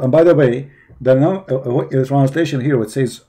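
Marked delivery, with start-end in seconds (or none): none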